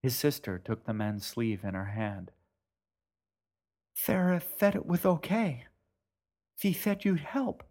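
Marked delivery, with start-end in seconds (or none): 3.9-7.6 put-on pleading girl. Girl begging boatman.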